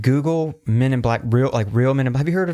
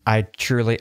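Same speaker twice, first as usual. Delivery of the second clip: ordinary delivery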